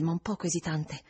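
usual speaking voice

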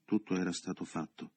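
usual speaking voice

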